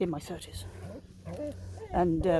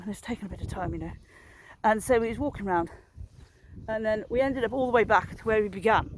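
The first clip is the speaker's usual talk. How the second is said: ordinary delivery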